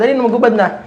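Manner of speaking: loud, high-pitched nagging; impatient hurrying. Acted. Mother telling Cindy to undress, impatient and loud. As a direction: hurry the child along loudly.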